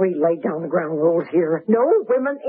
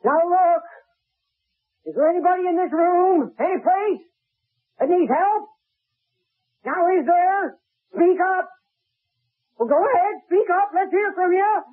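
high, sing-song calling to the room; landlady mockingly calling to an empty room; 9 semitones higher than usual. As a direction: call out mockingly to nobody.

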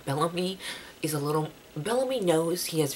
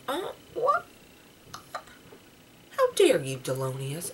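mock outrage; 3 semitones higher, 5 dB louder than usual. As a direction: scold him in playful disbelief. Outraged at character's betrayal.

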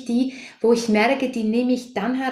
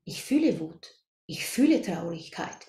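"ich fühle Wut" said lower and softer, "fühle" stressed; calm recognition; 4 semitones lower, 5 dB quieter than usual. Shown as a demonstration. Coach shows the group the calm way to name a feeling, "ich fühle Wut" said low and soft. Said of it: "Aber wenn wir erkennen".

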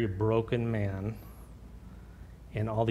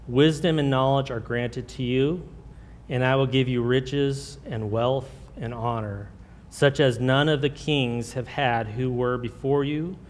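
louder, slower, solemn reading of the verse; scripture reading; 3 semitones higher, 5 dB louder than usual. Acted. Pastor reads God's promise to Solomon, louder, slow and solemn. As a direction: proclaim the Lord's words to the church.

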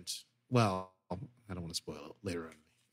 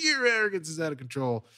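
mock retort; 7 semitones higher, 4 dB louder than usual. Retort echoing the insult.

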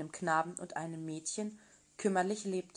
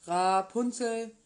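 calling again; 6 dB louder, slower than usual. Acted. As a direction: call her name once more, drawn out. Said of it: "kam und rief".

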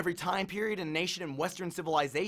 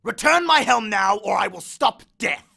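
much louder, higher threat; threatening; 5 semitones higher, 8 dB louder than usual. Acted. Hades threatens Percy, loud ultimatum. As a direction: hurl the ultimatum. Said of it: "Hades threatened".

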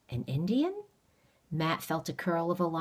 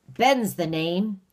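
slow stranger; 3 semitones higher, 6 dB louder, slower than usual. Acted. Stranger introduces himself.

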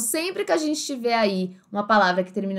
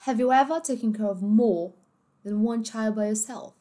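British English, ever and more without R; British accent. Shown as a demonstration. Teacher reading the example sentence, British accent. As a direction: swallow every final R, British-style. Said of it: "no inglês britânico".

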